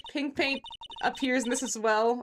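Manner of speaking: ordinary talk, nothing marked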